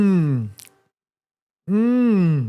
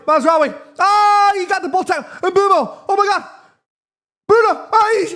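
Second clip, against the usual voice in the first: much higher and louder, yelling players' names; real excited yells building with the attack; excited shouting; 12 semitones higher, 4 dB louder than usual. Real, not acted. Fan watching United, shouting players' names at the screen.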